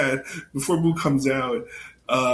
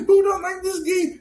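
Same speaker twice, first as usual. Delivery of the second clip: put-on childish Buu voice; 4 dB louder than usual. Voicing Buu's childish complaint.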